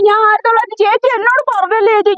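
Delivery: high, wounded protest; hurt indignation. Acted. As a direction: cry out at the insult, pitched high. Insulted woman protests to neighbour.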